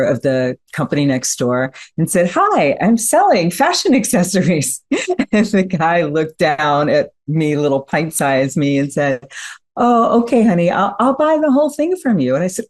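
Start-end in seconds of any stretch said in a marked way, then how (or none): 2.3-4.8 put-on higher, bright little-girl sales pitch with a laugh; mock little girl pitch. Child selling to neighbor. 9.8-12.3 put-on higher, amused voice humoring a small child; indulgent grown-up.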